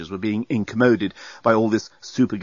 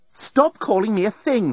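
indignant objection; 11 semitones higher than usual. A narrator's reading. Nettie objects to being called thing.

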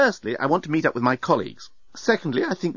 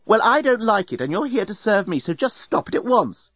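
higher, cross and bossy; firm order; 10 semitones higher than usual. A narrator's reading. Passenger orders bellbot to stop, cross. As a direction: lay down the law to a servant.

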